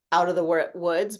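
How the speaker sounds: ordinary talk, nothing marked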